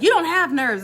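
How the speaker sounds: mock mom voice, high and loud; imitating a scolding parent. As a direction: snap back like a mom shutting it down.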